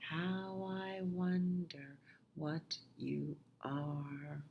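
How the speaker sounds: whispered, slow lullaby ending. Acted. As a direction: breathe it out slowly. Final line whispered slowly.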